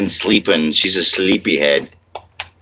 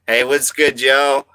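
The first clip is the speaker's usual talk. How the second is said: higher, playful show voice for the stream; put-on voice; 6 semitones higher, slower than usual. Greeting the chat in a put-on show voice. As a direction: call out a hey like a hype host. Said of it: "I'm confused by the voice".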